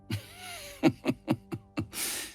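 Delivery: high, drawn-out gloating laugh; villainous chuckle. Acted. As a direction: chuckle over the remembered disaster. Villain recalls cities crashing down.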